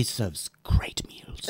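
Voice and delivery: slow sinister whisper. Acted. Bunny hints darkly about the witch agent, whispering slowly.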